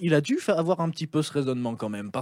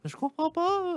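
high squeaky little voice, much higher; small high-pitched voice; 12 semitones higher, 6 dB quieter, slower than usual. Retold or imitated. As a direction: squeak it out like a tiny frightened man. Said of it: "il avait une petite voix aiguë comme ça".

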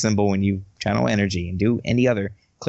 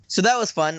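normal talking voice

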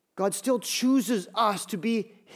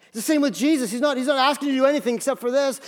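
ordinary delivery

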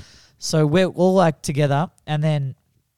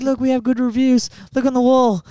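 mock proud owner; 8 semitones higher than usual. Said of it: "he's like".

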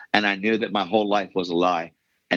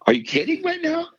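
much higher, in shocked disbelief; stunned disbelief. Retold or imitated. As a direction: squeal it out like you can't believe it. Retells his shocked reaction.

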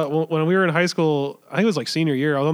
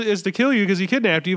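ordinary delivery

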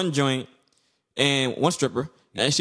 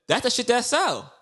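quoting [name]; 8 semitones higher than usual. Retold or imitated. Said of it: "remember she said".

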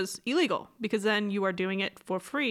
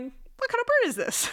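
high, puzzled bird-spotter quote; lightly acted puzzlement; baffled birder. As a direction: ask it high, in mock bafflement. Puzzled birder voiced in a story.

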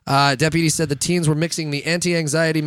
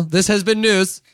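louder formal anchor voice; news anchor sign-off; 4 semitones higher, 5 dB louder, slower than usual. Shown as a demonstration. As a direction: sign off like a pro newscaster. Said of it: "Can you tell I'm a professional?".

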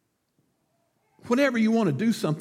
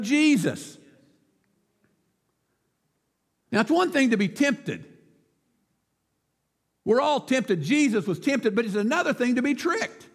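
higher, projected sermon delivery; emphatic preaching; 8 semitones higher than usual. Real, not acted. Preacher urging Christians to speak up, emphatic. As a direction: drive each phrase home to the congregation.

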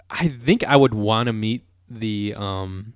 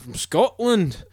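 attempted Scottish accent; 7 semitones higher, much slower than usual. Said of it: "Let me see if I can do the Scottish accent".

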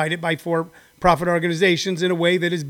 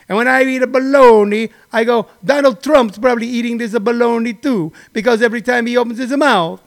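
mock Italian-American; 2 semitones higher than usual. Italian guy scolding Donald Trump, hammed-up Italian-American accent.

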